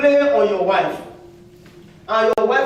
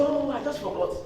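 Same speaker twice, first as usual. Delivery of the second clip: higher, soft mock excuse; 5 semitones higher, 7 dB quieter than usual. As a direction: fake a lame excuse lightly. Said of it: "Sometimes you say".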